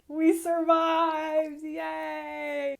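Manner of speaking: high, loud, slow triumphant cheer. Real, not acted. Narrator cheering after the crash landing, excited. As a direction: stretch out the victory cry.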